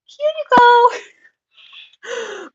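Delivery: high, wailing, drawn-out mock sob; hammed-up tearful whine; mock crying. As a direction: whimper it out like you're about to cry. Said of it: "I'm just, like, sitting here like".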